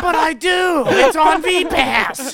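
high, loud, slow whining shout; whiny kid shout. Retold or imitated. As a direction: yell it like a bratty kid. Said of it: "He's like".

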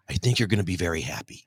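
low, soft, half-muttered Trump voice; a modest try at Trump's voice; Trump impression. Guest imitating Trump telling him he'll be happy, low and soft. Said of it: "in the best Trumpian example that I can muster".

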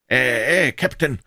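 high, loud aye captain; barked drill commands. A narrator's reading. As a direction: call out the acknowledgement. Crew acknowledges order. (gebellte Bestätigung)

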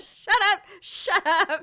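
loud, high mock shout; hammed-up high plea to stop; exasperated self-scolding. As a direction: yell it at yourself in mock despair. Retold telling herself to stop.

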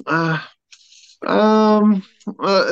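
a hint of mom stalling; imitating his mom. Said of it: "this is kind of an impression of my mom".

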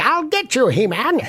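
high villain snarl at He-Man; lightly voiced cartoon villain; Skeletor voice. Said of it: "What did Skeletor say?".

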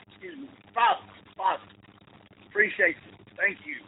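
polite interruption. Retold or imitated. Coach politely cutting off priest.

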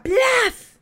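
loud, high imitated slam; door-slam noise. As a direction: spit out a sudden bang. (portazo imitado, fuerte y agudo)

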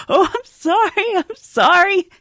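high, slow, sheepish apology; apologetic celebrity. Retold or imitated. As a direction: plead sorry from the jetway. Johnny apologizing.

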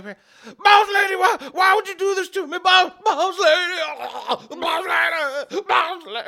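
high, loud wailing betrayal; crying with rage. Acted. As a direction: scream through pain at the boss. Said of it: "you hear him screaming and writhing".

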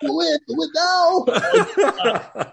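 high, slow strained yell; hammed-up action-hero command; Schwarzenegger. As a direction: stretch it into an Austrian-accented roar. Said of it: "just like Schwarzenegger".

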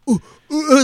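high, loud startled oohs; mock terrified. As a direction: act out jumping at every noise, half laughing. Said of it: "just fucking terrified the whole time".

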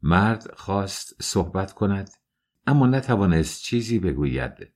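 ordinary delivery, nothing marked

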